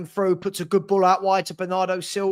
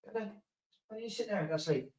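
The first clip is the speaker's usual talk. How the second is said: soft puppy lull; 2 semitones higher, 14 dB quieter, faster than usual. Real, not acted. Settling the puppy.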